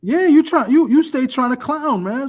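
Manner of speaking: high, loud playful trash talk; mock challenge. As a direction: square up to him jokingly, loud and high.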